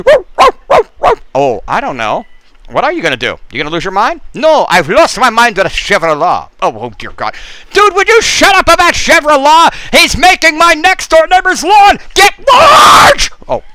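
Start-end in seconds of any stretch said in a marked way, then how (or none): 0.0-1.1 high silly woo woo; playful high-pitched woos; high woo calls. Acted. 7.7-13.3 mock angry neighbor yelling; annoyed shout.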